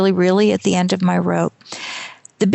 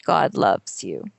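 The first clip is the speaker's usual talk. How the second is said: slow, hushed reveal; 10 semitones lower, 4 dB quieter, slower than usual. Retold or imitated. Storyteller revealing the skywriting's message, slow and low. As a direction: lower and slower, each word set apart.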